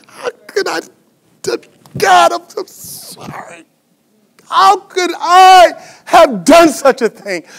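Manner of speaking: high, drawn-out wail of remorse; anguished, choked self-reproach; acted weeping. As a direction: sob the apology, then cry out loudly. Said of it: "he's weeping".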